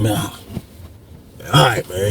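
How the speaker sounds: grudging agreement. Retold or imitated. Retold grudging giving in.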